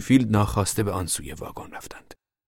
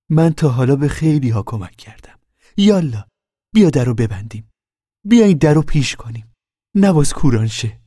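a lightly voiced brisk older neighbour, higher and louder; voiced Mrs Wainwright; 7 semitones higher, 8 dB louder than usual. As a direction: press the girl for an answer like a no-nonsense midwife. Mrs Wainwright takes charge.

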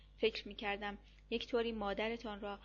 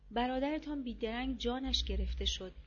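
plain reading voice

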